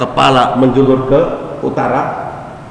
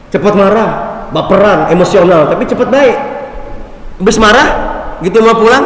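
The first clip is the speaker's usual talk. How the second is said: higher and louder, fervent preacher build; preaching at full force; 6 semitones higher, 3 dB louder, faster than usual. Real, not acted. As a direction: drive the point home with rising fire.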